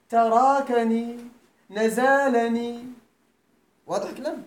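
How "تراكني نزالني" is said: "تراكني" and "نزالني" drawn out slowly and louder; teacher stresses each example word slowly; slow emphatic examples. Shown as a demonstration. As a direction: dictate "تراكني" then "نزالني" slowly, loud. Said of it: "تقول".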